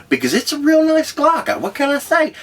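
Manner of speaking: excited brag. Real, not acted. Bragging about his gun.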